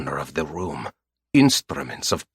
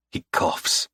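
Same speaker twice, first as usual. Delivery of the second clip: usual speaking voice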